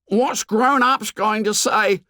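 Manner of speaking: high slow anxious cry. Acted. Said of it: "cried Piggy again".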